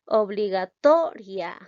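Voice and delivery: "Obligatoria" slowed, with TO loud and stretched; stress on TO. Shown as a demonstration. Teacher showing where the force falls, slow stress on TO in "Obligatoria". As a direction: punch and hold TO in "Obligatoria". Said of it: "aquí suena más la sílaba to".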